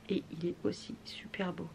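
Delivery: softly whispered aside. Real, not acted. Whispering to viewers about a pretty polish. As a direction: breathe it out as a secret aside.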